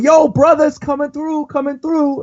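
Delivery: put-on high, slow, cocky punk kid; delinquent kid voice. Reading a manga kid's line to friends, high cocky punk voice. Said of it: "he's going".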